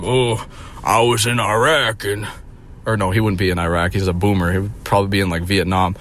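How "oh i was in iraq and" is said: slow, put-on veteran voice; imagined war vet. Pretending the boomer is a war vet, slow veteran voice. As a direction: play a world-weary old soldier.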